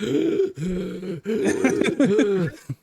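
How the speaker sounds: high, loud comic gasps; imitated labored gasping. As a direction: gasp helplessly as the carried victim. Acts out Zorbus carried, out of breath.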